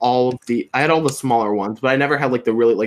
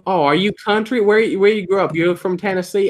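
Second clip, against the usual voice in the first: higher-pitched quoted questioning; imitating nosy strangers; 7 semitones higher than usual. People asking if he is country, higher imitated questioning. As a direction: lightly mimic curious people quizzing you. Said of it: "people will be like".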